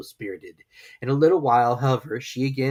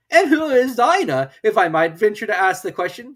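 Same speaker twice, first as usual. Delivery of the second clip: high, loud polite bird; Lory voice; 10 semitones higher, 9 dB louder than usual. Acted. Lory asking Alice, loud and polite. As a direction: venture politely.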